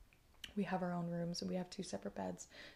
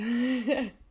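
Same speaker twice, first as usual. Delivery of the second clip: laugh; 4 semitones higher, 9 dB louder than usual. Real, not acted. Laughing at herself.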